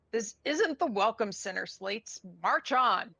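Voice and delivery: stern hobgoblin major. Acted. Major dismisses the Slates from her tent, stern. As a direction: send them off like an irritated officer. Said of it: "Her brow furrows".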